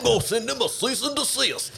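sing-song the threat in a put-on Alabama accent; the pie company owner in an Alabama accent, threatening a cease and desist. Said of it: "I just put an Alabama accent on".

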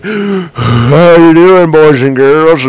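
loud, hammy bedroom voice drawn out on the first words; mock phone-sex voice. Shown as a demonstration. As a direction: ham up a phone-sex line operator. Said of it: "sounding like I'm having phone sex".

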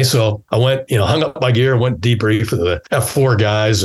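ordinary talk, nothing marked

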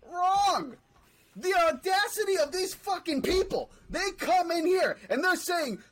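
loud, high, outraged; angry rant. Real, not acted. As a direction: blast it out in real outrage. Ranting about Cowboys fans.

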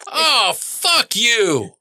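drawn-out, high-pitched angry holler; exasperated yell. Acted. Father yelling from another room, exasperated drawn-out holler. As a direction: holler it out slowly at the ballgame.

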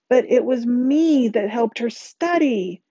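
high, drawn-out indignant protest; slow, high-pitched indignation; outraged. A narrator's reading. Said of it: "outrage in her voice".

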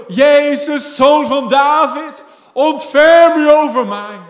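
loud, high, drawn-out shout; crying out. Acted. Blind Bartimaeus calling to Jesus for mercy, shouting out. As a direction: cry out slowly for mercy over a crowd. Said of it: "begon het uit te schreeuwen".

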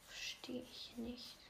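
puzzled whisper to himself. Real, not acted. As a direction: whisper it, baffled. Confused, muttering to himself.

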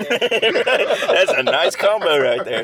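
slow, high and loud through laughter; laughing tease. Real, not acted. Teasing a friend about his ex, laughing. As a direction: tease a friend while cracking up.

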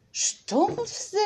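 a high, rising Stumpfsinn? savored with delight; delighted surprise. A narrator's reading. Frau Honig hears a word she loves: Stumpfsinn? rising high. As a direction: taste the word Stumpfsinn as a new treat. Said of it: "wiederholte noch einmal".

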